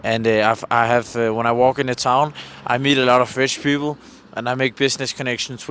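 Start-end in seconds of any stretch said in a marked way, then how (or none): none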